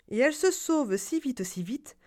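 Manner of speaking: ordinary delivery, nothing marked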